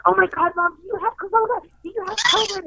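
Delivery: high-pitched mock panic; freaking out. Panicked daughter questioning her feverish mom, acted high and frantic. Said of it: "I start freaking out".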